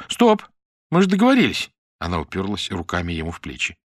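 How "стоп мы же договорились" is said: high, loud stop call; sharp stop. Acted. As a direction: halt him sharply. Mira stops him sharply.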